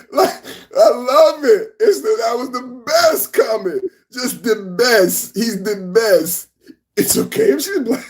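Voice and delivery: high-pitched, helpless laughing delight; tickled to bits, voice shooting up; cracking up. Real, not acted. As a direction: squeal the words through real laughter. Cracking up at the Trump quote.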